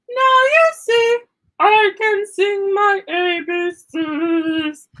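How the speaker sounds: high, slow puppet voice; proud puppet voice. Pretend. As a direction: boast like a proud little lion. Lion puppet boasts.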